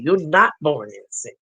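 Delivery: ordinary delivery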